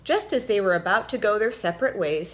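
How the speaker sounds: usual speaking voice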